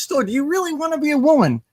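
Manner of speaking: spook's question. Retold or imitated. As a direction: toss the question out high and loud. Spook's blunt question, retold.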